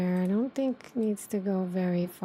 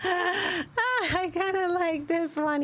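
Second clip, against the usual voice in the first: high, laughing delight; 8 semitones higher, slower than usual. Real, not acted. Streamer tickled by her own model, laughing. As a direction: talk through a fit of giggles.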